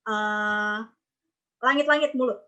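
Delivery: ordinary talk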